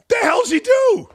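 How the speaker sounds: what on earth does he even do, high and loud; incredulous outburst. Real, not acted. Ranting about overpaid host.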